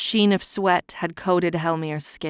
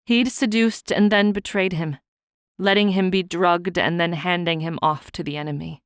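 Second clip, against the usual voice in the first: ordinary delivery, nothing marked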